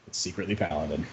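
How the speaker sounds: low, soft deadpan delivery of a pun; deadpan punchline. Real, not acted. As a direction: drop the pun quietly, bone dry.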